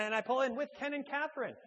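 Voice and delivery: normal talking voice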